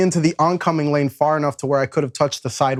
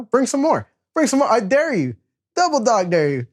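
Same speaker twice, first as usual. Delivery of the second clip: egging them on to bring more, pitched up; taunting dare; 8 semitones higher than usual. Retold or imitated. Him daring the gang to bring friends, pitched up and taunting.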